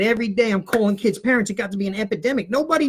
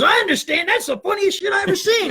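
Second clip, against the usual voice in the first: laughing cop; 9 semitones higher, 3 dB louder than usual. Retold or imitated. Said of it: "the cop goes".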